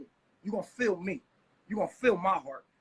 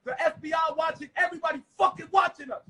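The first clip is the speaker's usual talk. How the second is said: angry shouting; 4 semitones higher, 4 dB louder than usual. Real, not acted. Heated argument with wife.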